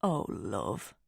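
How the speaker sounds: low, soft fatherly sympathy; hushed, deep-voiced concern from a dad; tender consoling. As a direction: drop low and gentle to comfort her. Dad consoles his tearful daughter.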